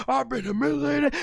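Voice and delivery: mock outrage, very high-pitched; Stone Cold. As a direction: imitate a furious wrestler wailing in indignation. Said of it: "the way Austin was mad".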